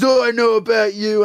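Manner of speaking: loud, high, menacing boss quote; mock the boss snapping at him; boss's threat. Australian boss confronts him at the booth, menacing loud line. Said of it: "and he goes".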